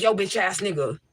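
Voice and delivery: hammed-up rude address to a man; mock tough talk. Woman showing men how not to address them, brash mock tough talk. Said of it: "You don't want me to be like".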